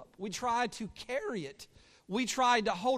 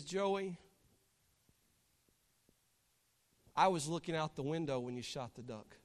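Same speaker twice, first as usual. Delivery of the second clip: softer, tender understanding; gentle grandmother; 3 semitones lower, 5 dB quieter than usual. Acted. Grandma holding Joey after his confession, gentle. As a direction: let the boy know gently, in a low hush.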